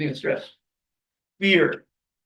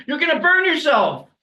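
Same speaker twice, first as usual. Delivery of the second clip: warning parent; 9 semitones higher, 5 dB louder than usual. Retold or imitated. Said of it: "instilling fear".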